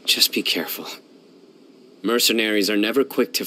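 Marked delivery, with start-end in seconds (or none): 0.1-0.9 whispered warning. Acted. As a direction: say it under your breath. Quiet warning after a fight.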